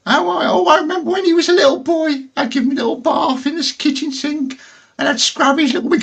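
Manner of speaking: very high, squeaky granny greeting; old granny voice. Pretend. Nana Scab greeting the birthday boy in a high granny voice. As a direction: sweetly greet little Johnny as a doting nan. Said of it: "she'd be like".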